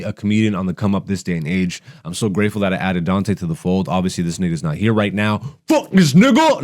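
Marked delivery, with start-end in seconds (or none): 5.7-6.6 mock angry outburst. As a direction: yell it like you snapped, then drop it. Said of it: "No, I'm just joking".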